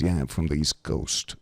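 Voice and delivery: low East Coast wise-guy voice; Pasquale. Retold or imitated. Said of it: "just like this. He goes".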